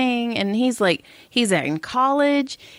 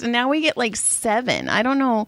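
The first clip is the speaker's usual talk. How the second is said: ordinary talk, nothing marked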